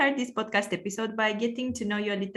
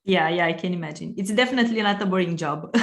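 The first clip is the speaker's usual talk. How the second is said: normal talking voice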